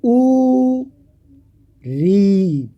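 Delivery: ऊ and री said with the mouth barely open; vowel demo. Shown as a demonstration. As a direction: give ऊ then री with a nearly closed mouth. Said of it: "सबसे कम मुख खुलेगा".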